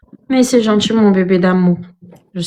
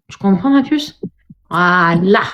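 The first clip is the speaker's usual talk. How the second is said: ordinary talk, nothing marked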